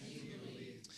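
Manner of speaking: whispered very softly. Shown as a demonstration. Preacher echoing the phrase to the congregation, whispered. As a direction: breathe it as a hushed secret. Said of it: "Everybody say".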